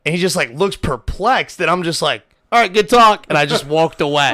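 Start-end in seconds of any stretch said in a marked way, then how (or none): 2.5-3.3 loud, high comic brush-off; retold exit line, pitched up and loud; abrupt sign-off. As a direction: call it out and be done.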